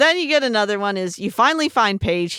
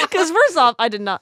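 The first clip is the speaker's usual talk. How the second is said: usual speaking voice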